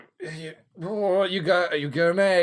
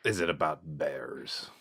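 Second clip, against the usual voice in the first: whisper; 12 semitones lower, 10 dB quieter than usual. Pretend. Mock hushed question.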